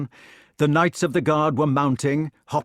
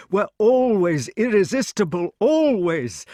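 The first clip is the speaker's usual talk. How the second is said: put-on higher, insistent old king; vehement; 7 semitones higher, 4 dB louder than usual. A narrator's reading. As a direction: press the words home with fervour. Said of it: "stated the king vehemently".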